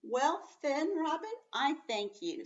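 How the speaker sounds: "Well then" lifted high like an exclamation; exclaimed Well. Shown as a demonstration. Teacher rereading the line as an exclamation, high. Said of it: "So he's giving an exclamation".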